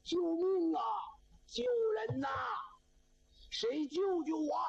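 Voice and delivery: acted high-pitched wail for help; cry for help. A narrator's reading. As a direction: call out pleadingly from inside a locked room. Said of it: "就听那里边喊".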